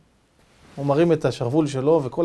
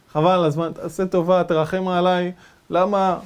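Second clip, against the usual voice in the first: ordinary talk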